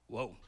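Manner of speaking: low drawn-out whoa, like braking; a long low whoa to slow down; slowing-down sound. Shown as a demonstration. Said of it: "that was a good sound effect".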